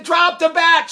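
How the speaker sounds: loud high command; coach's yell. Retold or imitated. Coach yelling at Tracy to drop the bat. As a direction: bark it at the runner. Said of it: "He said".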